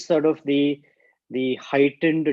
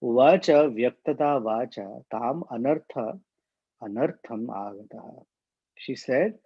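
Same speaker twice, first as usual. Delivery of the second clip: ordinary delivery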